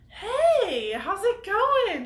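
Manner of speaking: high, loud, breezy greeting; happy as a clam. Retold or imitated. Rolling up on a dirt bike, greeting breathless friends, cheery. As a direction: sing it out, cheerful and fresh, slow on the question. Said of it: "Just happy as a clam".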